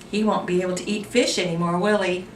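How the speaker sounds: ordinary delivery, nothing marked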